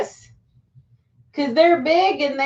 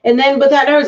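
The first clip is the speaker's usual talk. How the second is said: ordinary talk, nothing marked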